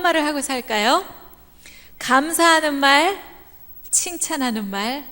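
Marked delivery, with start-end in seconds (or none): 2.0-3.2 loud, slow and high; emphatic. Real, not acted. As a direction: proclaim it to the hall. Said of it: "preacher emphasizing good words".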